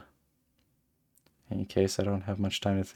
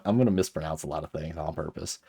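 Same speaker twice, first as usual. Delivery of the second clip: usual speaking voice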